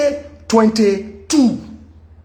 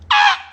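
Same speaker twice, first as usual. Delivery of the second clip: surprised cry. Retold or imitated. Shocked at the unthanked donation.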